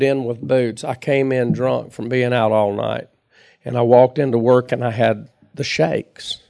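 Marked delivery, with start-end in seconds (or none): none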